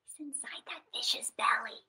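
hushed secret whisper; storyteller's hushed reveal. Acted. Secret told to kids.

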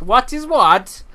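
loud without hearing himself; too loud over the headphones. Real, not acted. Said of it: "know if I'm being loud".

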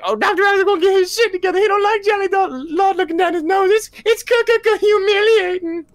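very high squeaky cartoon clown. Fan imitating a rock and roll clown in a high squeak. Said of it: "he's like".

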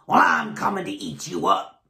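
low, menacing troll growl; deep monster troll. A narrator's reading. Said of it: "And the troll said".

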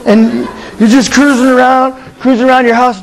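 ordinary talk

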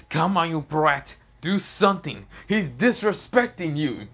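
loud, high, drawn-out goading; acted exasperation, pushed up and out; indignant urging. Insulted dragon goads Issei into acting, loudly indignant.

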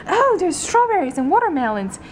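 high, gushing room-tour voice; mock YouTuber. Hosting a pretend apartment tour for viewers, mock YouTuber gushing. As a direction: show off the fridge with put-on delight. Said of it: "all the YouTubers do that".

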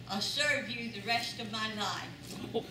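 ordinary talk

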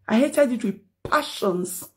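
ordinary delivery

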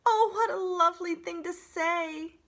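very high, loud gushing voice; delighted opossum. Acted. Opossum thrilled by the compliment, very high and loud. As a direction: gush with theatrical delight.

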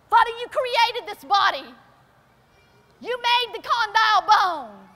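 loud, high, pleading prayer; crying out to God. Retold or imitated. Mother praying over her sick baby, loud and pleading. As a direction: cry out to the Father for her baby. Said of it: "I began to cry out to God".

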